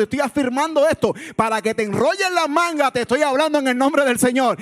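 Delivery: high, building to a loud shout; impassioned, shouted pulpit charge; preaching at full force. Real, not acted. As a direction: exhort the church with rising intensity.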